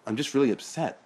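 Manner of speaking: high, loud hurt complaint; upset. Acted. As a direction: whine it out, hurt. Sean upset about brother.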